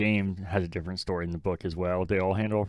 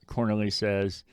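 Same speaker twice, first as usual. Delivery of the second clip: ordinary talk, nothing marked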